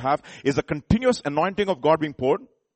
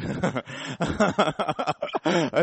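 long burst of real laughter; amused, rolling laugh. Real, not acted. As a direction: laugh heartily, letting it run on. Laughs at audience's reaction to remark.

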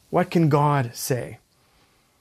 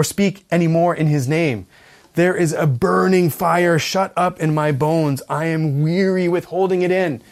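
impassioned scripture reading; 3 semitones higher, 4 dB louder than usual. Acted. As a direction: declaim the prophet's lament, falling away. Reads Jeremiah's lament aloud.

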